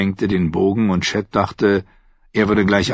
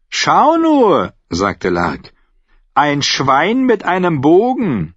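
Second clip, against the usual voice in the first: höhnisch; 8 semitones higher, 3 dB louder, slower than usual. A narrator's reading. Lark zeigt höhnisch auf Sam.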